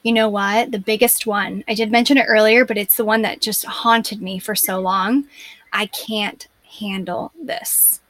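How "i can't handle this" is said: slow, breathless whisper. Retold or imitated. Recalling the belief that haunted her, in a slow whisper. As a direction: breathe it out slowly like a secret fear.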